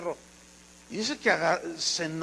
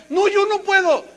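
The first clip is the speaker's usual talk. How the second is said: high, louder pleading whine; loud whiny refusal; 12 semitones higher, 8 dB louder than usual. Acted. Moses loudly whining his refusal to God. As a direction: dig in and beg off.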